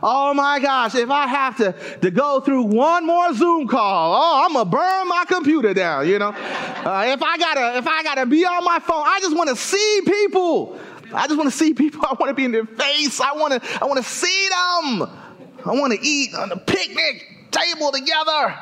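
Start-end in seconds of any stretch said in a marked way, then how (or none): 0.0-6.1 mock-exasperated outburst, higher pitched; exasperated crowd. Preacher imitating people fed up with pandemic video calls, exasperated. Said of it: "everybody just like". 7.1-18.6 high-pitched, hammed-up pleading; exasperated pleading. Preacher imitating everyone starved for company in lockdown, mock-desperate pleading. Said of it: "everybody just like".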